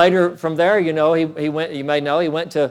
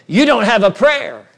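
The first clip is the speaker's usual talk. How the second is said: loud, raised-pitch verdict, imitating the devil; retold devil's voice; 6 semitones higher, 5 dB louder than usual. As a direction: throw out the devil's taunt forcefully. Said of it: "when that voice came".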